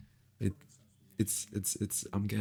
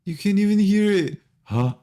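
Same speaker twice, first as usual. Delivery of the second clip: shouting; 7 semitones higher, 13 dB louder than usual. Real, not acted. Mic check shouting at stream.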